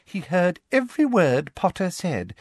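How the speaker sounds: high, triumphant account; Umbridge, smug. A narrator's reading. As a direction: score a point smugly. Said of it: "said Umbridge smugly".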